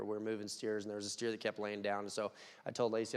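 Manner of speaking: normal talking voice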